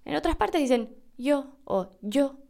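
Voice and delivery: "yo" said with a plain y glide, as "jo"; other Y sounds. Shown as a demonstration. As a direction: give "yo" the jo pronunciation. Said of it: "van a escuchar a gente decir yo".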